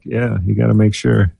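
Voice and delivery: ordinary delivery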